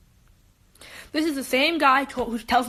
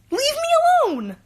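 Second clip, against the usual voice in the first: much higher, fed-up plea; exasperated; 14 semitones higher, 3 dB louder than usual. Retold or imitated. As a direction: snap it high and fed up, pushing him away. Retold snapping at classmate.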